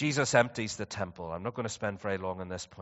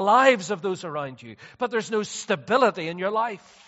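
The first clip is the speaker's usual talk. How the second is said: raised, challenging pulpit voice; high, emphatic sermon delivery; forceful preaching; 10 semitones higher, 12 dB louder than usual. Real, not acted. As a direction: press the congregation loudly.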